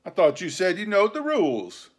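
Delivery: high, loud pirate scorn; contemptuous. A narrator's reading. Silver to George about the rules, contemptuous. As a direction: sneer it at an upstart. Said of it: "returned John contemptuously".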